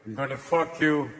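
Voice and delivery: low, flat muttered read; low deadpan script read; script writer. Retold or imitated. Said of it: "Somebody's writing this stuff going".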